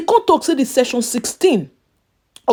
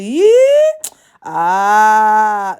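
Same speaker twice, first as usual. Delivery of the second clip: mock surprise; 2 semitones lower, 5 dB louder than usual. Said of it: "I can't to say".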